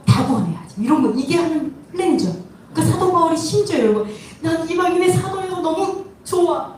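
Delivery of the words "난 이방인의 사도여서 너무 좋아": high-pitched, gleeful quote; playful, pitched-up happiness; acted delight. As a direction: act out being thrilled with your job. Voices a delighted apostle Paul.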